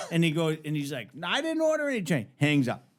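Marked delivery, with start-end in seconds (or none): 1.1-2.3 high, quick mock protest; retold exasperation; exasperated denial. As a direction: rattle off a high objection. Called man protests, hangs up.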